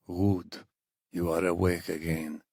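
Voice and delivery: put-on soft captor with a thick Iranian accent. A narrator's reading. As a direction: speak quietly in a heavy Iranian accent. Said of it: "asked in a thick accent".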